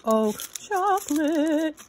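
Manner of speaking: high, loud, drawn-out delight; loud, gleeful high cry; excited squeal. Real, not acted. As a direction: stretch the word out high with glee. Delighted at chocolate gift.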